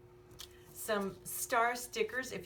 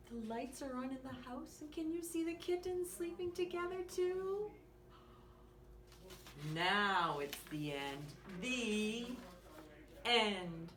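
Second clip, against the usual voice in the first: put-on lively bedtime story read to a small child; reading to children; 3 semitones higher, 7 dB quieter than usual. Reading a bedtime story to young listeners, lively and gentle. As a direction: read it like a bedtime picture book. Reads picture book aloud.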